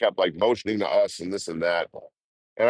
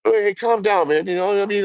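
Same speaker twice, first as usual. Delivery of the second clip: high put-on taunting voice; needling the angry local; mocking calm-down; 11 semitones higher, 3 dB louder than usual. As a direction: tease him to calm down, high.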